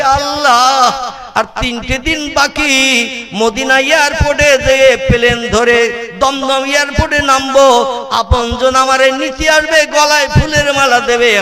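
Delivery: high, chanted pilgrim's prayer; mock tearful plea. Pilgrim at the tomb begging for a safe return, mock tearful plea. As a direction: plead to God in a wailing sermon tune. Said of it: "কাঁদছে আর বলছে".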